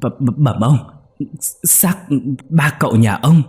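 lắp bắp, giọng vọt cao và to; lắp bắp sợ hãi. A narrator's reading. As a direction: run sợ nói không nên lời. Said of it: "lắp bắp nói". (người làm lắp bắp báo tin, giọng sợ hãi vọt cao)

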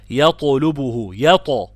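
slow يطلبه with a bouncing, heavy ط; echoing emphatic ط in يطلبه; qalqala demonstration. Shown as a demonstration. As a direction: recite يطلبه, releasing the ط with an echo. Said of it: "والطاء ساكنة مقلقلة".

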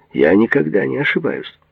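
high, indignant rebuff; cutting retort. A narrator's reading. Patient rejects the doctor's doubt, cutting retort. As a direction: shut the doubt down at once. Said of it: "отрезал Бурк".

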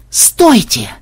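high yelled plea to halt; yelling. A narrator's reading. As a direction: cry out to stop them. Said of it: "завопил программист".

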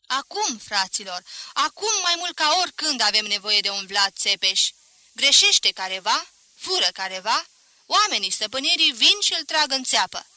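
loud, emphatic public speech; rally a crowd with rising force; rousing oration. A narrator's reading. Professor addressing the crowd from a bench, rousing oration.

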